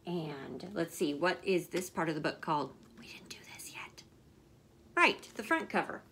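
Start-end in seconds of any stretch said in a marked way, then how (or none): 3.0-4.0 quick whispered aside; breathy little whispered aside. Real, not acted. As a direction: drop to a secret whisper. Aside to viewer while reading.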